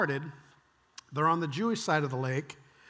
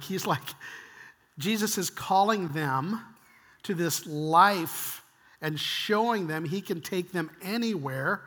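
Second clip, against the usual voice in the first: ordinary delivery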